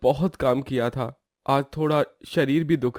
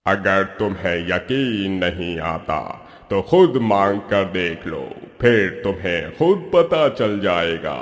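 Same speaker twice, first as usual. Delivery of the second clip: voice from the sky; 7 semitones lower than usual. Acted. Magic cave answering the lazy man, booming divine voice. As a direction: speak as an unseen god from above. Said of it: "एक आकाशवाणी होती है".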